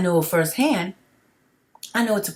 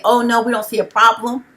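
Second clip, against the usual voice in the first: mocking officials; 6 semitones higher, 6 dB louder than usual.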